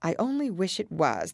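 usual speaking voice

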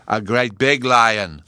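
loud, slow, emphatic correction; insistent little boy putting someone right; correcting child Bruno. A narrator's reading. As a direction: butt in loudly and stretch each word. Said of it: "Bruno corrected her".

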